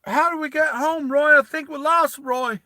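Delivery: put-on high, innocent child's voice; little boy voice. Little brother asking Roy the way home, high and childlike. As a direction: sound like a lost little kid asking for his big brother. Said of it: "innocent little kid".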